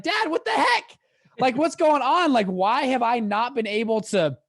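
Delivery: high-pitched, loud mock confrontation; indignant outburst. Son demanding answers from his dad, indignant and high.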